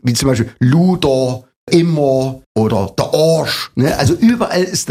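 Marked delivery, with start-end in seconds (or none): 0.6-3.7 "Luder, immer, Arsch" with dark drawn-out O sounds; Saxon O vowel. Shown as a demonstration. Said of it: "Der unbekannte Vokal ist das O".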